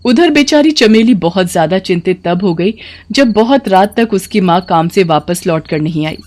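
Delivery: plain reading voice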